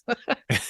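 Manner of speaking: squeaky burst of laughter; high laughing burst. Real, not acted. Storyteller bursts into helpless high laughter.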